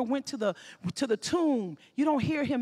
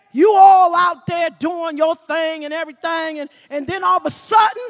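loud, high preaching cadence; full-force preaching; 8 semitones higher, 15 dB louder than usual. Acted. Preacher pressing the congregation about the Lord's warnings, full force. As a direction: drive it home from the pulpit.

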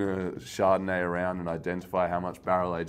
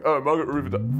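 mock wine snob; 5 semitones higher, 4 dB louder than usual.

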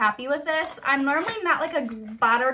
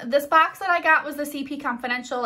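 normal talking voice

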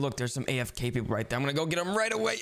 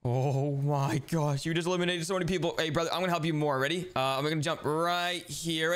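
ordinary delivery

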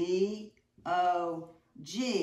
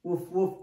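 dog bark; 3 dB louder than usual. Shown as a demonstration. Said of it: "he's not a cat, is he?".

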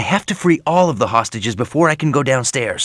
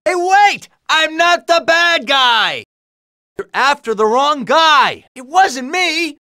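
high, drawn-out protest of innocence; high-pitched indignant yelling; protesting shout; 11 semitones higher, 3 dB louder, slower than usual. Acted. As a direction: plead your innocence at the top of your range. Hero protests being wrongly accused.

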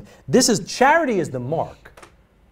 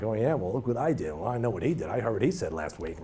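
soft, grudging muttering to one-up him; petty jealousy; 6 semitones lower, 10 dB quieter, faster than usual. Acted. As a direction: sound sour about his success and brag about your own. Jealous rival belittling a brother.

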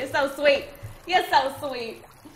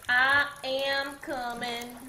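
slow drawn out; much slower than usual. Pretend.

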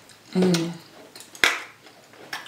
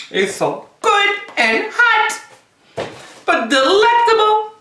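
high, slow, squealing praise; delighted; 11 semitones higher, slower than usual. Real, not acted. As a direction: squeal it out, savoring the heat. Tasting hot sauce, squeaky praise.

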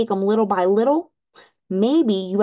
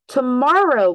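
a loud, higher cry of Tomorrow; cried out; 5 semitones higher, 5 dB louder than usual. A narrator's reading. Toad cries out at Frog's list of chores. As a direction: fling it out like a fed-up toad. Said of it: "cried Toad".

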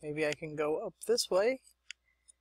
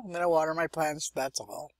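ordinary talk, nothing marked

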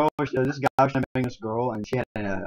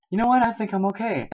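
put-on higher-pitched quoted girl; imitated girl; 9 semitones higher than usual. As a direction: lift the voice high to play her. Voicing the girl in retold movie.

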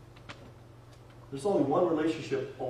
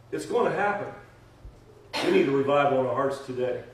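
usual speaking voice

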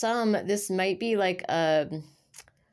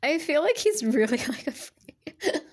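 high, breaking into laughter; laughing; 9 semitones higher than usual. Real, not acted. Reader laughing at the shy man in her reading. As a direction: giggle through it, trying to hold it in. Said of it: "I shouldn't laugh".

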